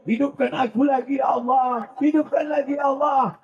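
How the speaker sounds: pleading dead soul. Acted. Dead begging again.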